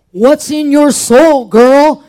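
slow, loud, in her face; slow loud demand, right in her face; confrontational challenge. Retold or imitated. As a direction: lean in and press her hard. Confronting friend, getting in face.

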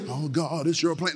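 soft, meek little prayer; mock timid prayer. Shown as a demonstration. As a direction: whisper it like a nervous plea. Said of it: "I'm not making it".

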